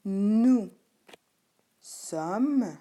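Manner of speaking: stretched, separated words; word-by-word dictation. Shown as a demonstration. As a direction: dictate slowly and loudly, one word at a time. Teacher dictating verb forms.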